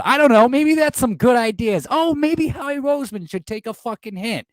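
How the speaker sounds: high, sarcastic ranting; mock the GM with scorn. Real, not acted. Ranting about the front office.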